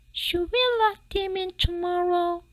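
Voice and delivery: wife asking her husband, worried and high. Acted. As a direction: fret it out in a high voice. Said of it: "Missus Dussault wondered".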